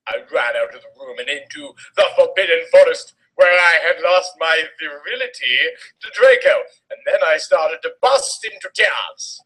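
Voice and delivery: ordinary talk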